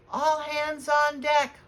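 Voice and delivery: a captain shouting over the gale; holler. Acted. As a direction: bellow the order over the storm. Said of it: "hollered Captain Pritchard".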